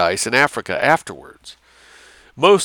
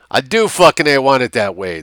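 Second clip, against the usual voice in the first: drawled; 5 semitones higher, 6 dB louder than usual. A narrator's reading.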